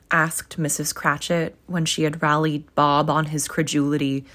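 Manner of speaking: ordinary delivery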